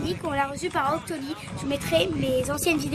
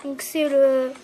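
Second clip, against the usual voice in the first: ordinary talk, nothing marked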